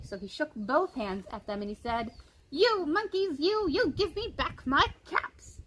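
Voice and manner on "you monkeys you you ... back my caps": high, mildly angry demand; angry peddler. A narrator's reading. Peddler growing angry at the monkeys, high demand. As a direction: scold them a bit harder. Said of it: "This made the peddler angry".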